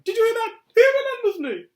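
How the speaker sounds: very high, loud and overjoyed; ecstatic cry. A narrator's reading. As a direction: proclaim it to the whole room in delight. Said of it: "cried Diddulus Diggle".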